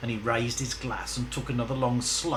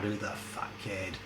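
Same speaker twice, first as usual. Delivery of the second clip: ordinary delivery, nothing marked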